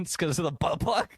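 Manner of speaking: ordinary talk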